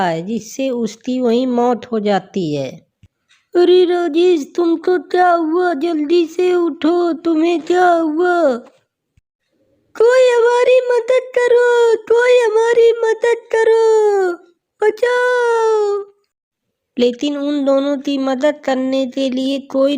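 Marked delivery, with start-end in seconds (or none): 3.6-8.6 higher-pitched, urgent alarm. Acted. Girl urging fallen lover to get up, alarmed. 10.0-16.0 high, drawn-out plea; crying for help. Acted. Girl begging anyone to save her lover, wailing high.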